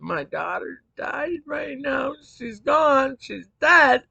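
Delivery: high, loud cry of disbelief, voice breaking upward; reliving the scream of a mother hearing her daughter died; retold anguished scream. Mother screaming to strangers that her daughter is dead, anguished high shout. I was crying so hard.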